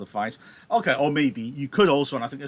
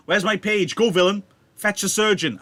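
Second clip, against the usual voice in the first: higher and louder, urgent call; calling for a surgeon; 7 semitones higher, 7 dB louder than usual. Acted. Wounded Mercutio calling his page, loud and urgent. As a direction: shout for your page.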